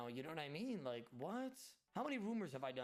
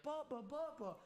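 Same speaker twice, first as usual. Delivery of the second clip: a hint of a little kid calling "Papa, Papa."; lightly voiced child calling for dad; toddler calling; 13 semitones higher than usual. Son runs to his dad, lightly voiced toddler.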